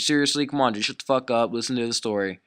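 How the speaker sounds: ordinary talk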